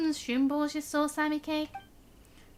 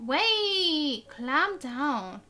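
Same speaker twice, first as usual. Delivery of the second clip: normal talking voice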